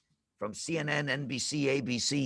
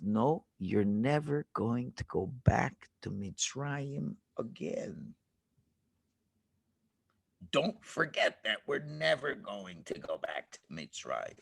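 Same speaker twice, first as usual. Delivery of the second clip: calm Moshe; 8 semitones lower, 6 dB quieter than usual. Acted. Moshe answers calmly.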